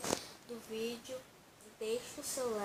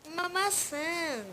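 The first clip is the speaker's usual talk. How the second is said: excited; 7 semitones higher, 6 dB louder, slower than usual. Real, not acted. Excited find in game.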